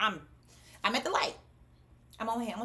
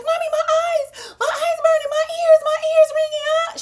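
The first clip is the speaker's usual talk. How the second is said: imitating a daughter's high-pitched shriek; very high mimicked crying scream; screaming, crying child; 12 dB louder than usual. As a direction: wail it loudly like a terrified child. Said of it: "screaming at the top of her lungs".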